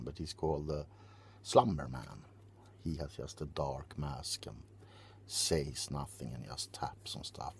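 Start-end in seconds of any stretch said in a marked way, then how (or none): 2.8-7.5 whispering. Acted. ASMR whisper description.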